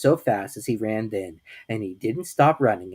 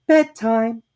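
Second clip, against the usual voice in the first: higher, louder cheerful mother's call; brisk motherly bedtime; 11 semitones higher, 9 dB louder than usual. A narrator's reading. Kanga to Piglet, pretending he is Roo, brisk and cheerful. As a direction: announce bedtime like a brisk mum.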